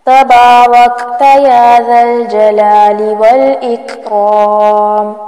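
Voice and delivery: slow, measured Arabic recitation of a dua. Shown as a demonstration. Teacher reciting a dua slowly for students. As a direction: recite each word slowly for learners.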